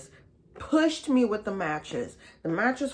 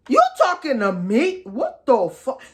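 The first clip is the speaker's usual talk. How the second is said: higher and louder indignant "You talking to me"; indignant retort; 6 semitones higher, 10 dB louder than usual. Retold or imitated. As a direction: snap back in outraged disbelief. Her retort to the roommate.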